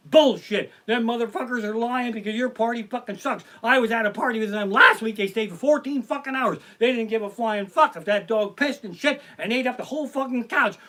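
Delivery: usual speaking voice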